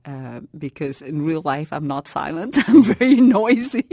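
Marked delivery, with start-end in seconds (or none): 2.7-3.8 laughing. Real, not acted. Laughs confessing noisiness.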